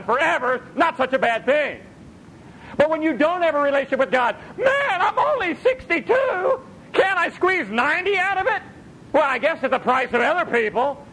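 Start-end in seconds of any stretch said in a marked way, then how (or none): 2.8-4.3 fast shouted sermon line; preaching shout. Real, not acted. 4.6-11.1 high, pleading mock old man; high wailing mock plea for longer life; whiny old man.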